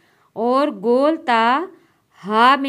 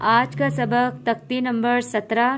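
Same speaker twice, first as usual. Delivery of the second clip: ordinary talk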